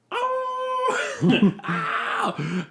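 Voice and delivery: very high-pitched imitated scream; mock scream. Reading how Mia was heard screaming, mock scream. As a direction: squeal out a pretend cry of pain. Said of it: "we hear Mia screaming".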